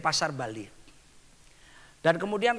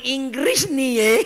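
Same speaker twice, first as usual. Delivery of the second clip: mocking demon; 6 semitones higher, 7 dB louder, slower than usual. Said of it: "Dia bisa ngomong".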